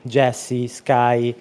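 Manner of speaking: normal talking voice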